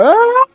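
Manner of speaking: very high mock scream; shrill, wailing shriek, imitated; imitated night-terror scream. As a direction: mimic his wife screaming in her sleep. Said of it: "I'm just screaming".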